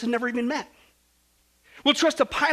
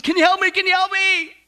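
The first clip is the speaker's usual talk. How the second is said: high, loud desperate plea; frantic pleading; 9 semitones higher, 9 dB louder than usual. Acted. Tourist on the cliff pleads with heaven, frantic begging. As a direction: beg urgently at a panicked shout. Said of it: "so the tourist pleads".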